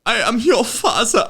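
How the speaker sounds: put-on high, loud, slow German-accented movie line; goofy German boy making a grand reveal; Augustus Gloop. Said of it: "another movie quote for you".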